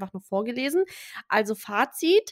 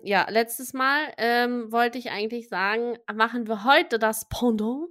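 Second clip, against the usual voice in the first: ordinary talk, nothing marked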